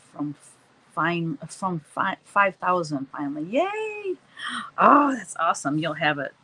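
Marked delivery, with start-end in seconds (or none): 3.4-5.1 a squealed, much higher cheer; excited yay. Real, not acted. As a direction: cheer for the milestone. Celebrating subscriber milestone.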